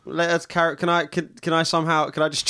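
usual speaking voice

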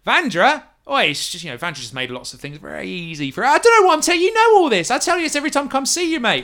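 nervous thug caught at knifepoint; collared ruffian pleading with the captor. Acted.

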